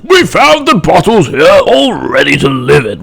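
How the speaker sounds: put-on an old fly, slow and careful; old fly answering, slow and lightly voiced; aged fly. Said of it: "a very aged blue bottle fly answered".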